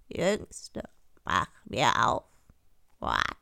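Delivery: low, slow frog chant; deep drawn-out frog voice; insistent frog. A narrator's reading. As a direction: call slowly, punctuate with croaks. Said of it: "klopfte es zum zweiten Mal und rief".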